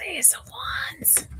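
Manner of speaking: slow, hushed whisper. Pretend. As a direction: whisper the card name. Card revealed in a whisper.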